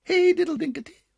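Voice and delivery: high, loud squeaky mouse call; mice answering. Acted. Little mice calling back to the cat, high and loud. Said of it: "answered the little mice".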